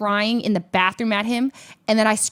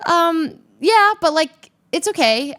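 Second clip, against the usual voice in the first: high defensive; 9 semitones higher, 6 dB louder than usual. Retold or imitated. Retelling own defensive reply.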